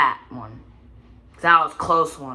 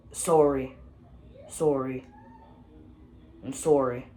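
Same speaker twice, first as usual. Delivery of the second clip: put-on Canadian accent. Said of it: "Yeah, I'm Canadian".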